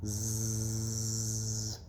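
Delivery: snore. A narrator's reading. Amy sleeps.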